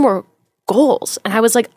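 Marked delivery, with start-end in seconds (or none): none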